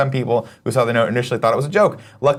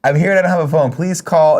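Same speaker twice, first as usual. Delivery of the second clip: acted desperate plea; 6 semitones higher, 5 dB louder than usual. Acting out note dramatically.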